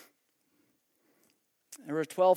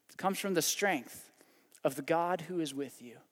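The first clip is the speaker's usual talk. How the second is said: usual speaking voice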